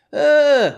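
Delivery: loud high exasperated ugh; exasperated groan about trialing; frustrated groan. Acted. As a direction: let out a fed-up groan. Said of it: "frustrated groan at trialing".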